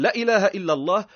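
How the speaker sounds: لا said quick and short with a rise; shortened la. Shown as a demonstration. Teacher imitating a careless reciter. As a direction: rush past لا without stretching it. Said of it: "ما طولش فيها".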